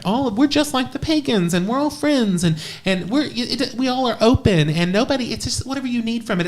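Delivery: high, sing-song mock of airy pagans; high mocking parody of fluffy paganism. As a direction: sneer at the all-friends crowd in a lilting high voice. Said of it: "play this game where it's like".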